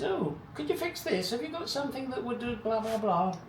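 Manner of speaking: very high mock wife voice; high, put-on pleading wife; imitated wife. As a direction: mimic your wife asking a favour in a high pitch. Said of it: "And then she says".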